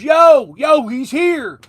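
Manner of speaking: loud, high "yo" dragged out slowly; excited shout. Retold or imitated. [name] recognising the fan in the dressing room, loud slow excited call. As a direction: hype him up like a star greeting a fan.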